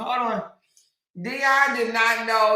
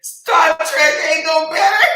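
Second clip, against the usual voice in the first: loud, high quote cracking with laughter; helpless laughter; 5 semitones higher, 8 dB louder than usual. Real, not acted. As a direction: blurt it out loudly while losing it. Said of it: "laughed like that".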